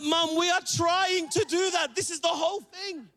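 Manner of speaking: high, drawn-out mock protest; hammed-up frustrated retort; acted exasperation. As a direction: stretch it out high, exasperated with mum. Exasperated acted reply to mum.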